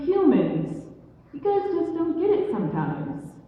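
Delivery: high-pitched fed-up complaint; exasperated scolding. Acted. Blue Jay fed up with humans.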